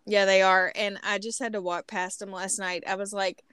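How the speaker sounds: normal talking voice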